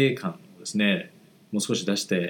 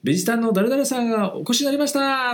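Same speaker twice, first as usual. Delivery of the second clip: high announcer call; announcing a visitor; 13 semitones higher, 3 dB louder than usual. Retold or imitated. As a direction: call out the guest's arrival. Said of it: "って言って".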